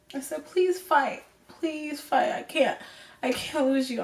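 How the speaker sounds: high, tearful voice, fading to a near whisper; tearful pleading. Real, not acted. Granddaughter begging sick grandpa to fight, tearful and high. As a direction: plead through real tears, pitch climbing. Breaks down retelling plea to dying grandpa.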